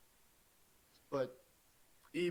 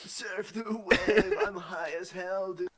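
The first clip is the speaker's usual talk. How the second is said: stoner surfer voice; 6 semitones higher, 9 dB louder than usual. Pretend. Mocking surfer stoner, laughing.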